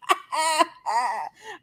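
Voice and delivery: high, crying-laugh squeal; real teary laughter; helpless laugh. Real, not acted. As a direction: break up laughing at yourself. Laughing at her own story.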